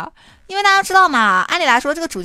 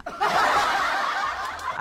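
laugh; 7 dB quieter than usual. Real, not acted. Laughs at own story.

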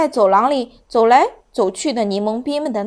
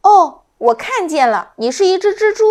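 puzzled; 8 semitones higher, 7 dB louder than usual. A narrator's reading. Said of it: "奇怪地说".

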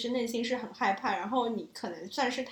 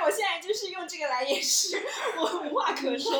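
high, laughing and lost for words; cracking up, pitch riding high; helpless laughter; 8 semitones higher, 5 dB louder than usual. Real, not acted. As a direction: talk through bursts of real laughter. Speechless, covering it with laughter.

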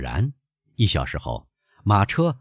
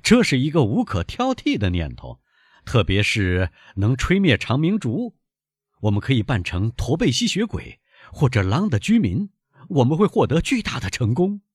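lightly raised, higher, teasing; mock cry; 7 semitones higher than usual. A narrator's reading. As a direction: exclaim with playful irony. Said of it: "弗朗兹嚷道". (弗朗兹半开玩笑地嚷道)

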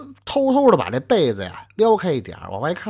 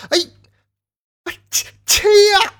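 much higher, louder stammering cry of grief; anguished wail; 7 dB louder than usual. Acted. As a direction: cry out to her in despair. Husband wails over dead wife. (撕心裂肺地哭喊)

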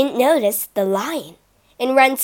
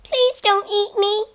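high, pleading little mouse; squeaky mouse; 9 semitones higher than usual. Acted. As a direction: squeak it out in fear. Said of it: "squeaked the mouse".

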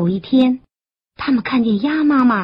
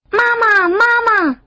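put-on high, loud child's call; 11 semitones higher, 4 dB louder, faster than usual. A narrator's reading. Tadpoles chasing who they think is mother, high calling. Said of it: "就追上去喊".

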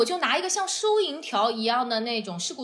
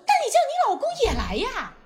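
laughing; 12 semitones higher, 3 dB louder than usual. Retold or imitated. Mocking tow driver's retort.

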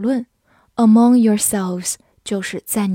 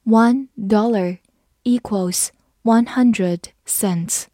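slow, careful reading for learners; slower than usual. Shown as a demonstration. Teacher reads slowly for the class. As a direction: read it slowly, word by word. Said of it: "好，慢读一遍。".

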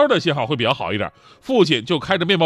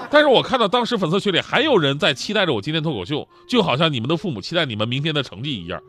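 ordinary talk

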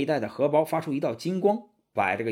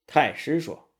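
ordinary delivery

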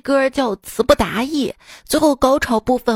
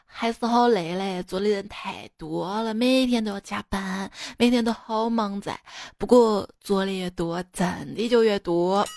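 lower, softer Sichuan dialect; 4 semitones lower, 5 dB quieter than usual. Retold or imitated. Chengdu speaker chatting about work on the phone, Sichuan dialect. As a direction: answer in broad Sichuanese. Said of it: "说话就是四川话".

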